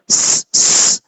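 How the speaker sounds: bare hissed s, whistled; whistling hiss. Shown as a demonstration. Teacher isolates the whistle sound of sad. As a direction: hiss out a sharp s. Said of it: "یہ سیٹی کی آواز ہے".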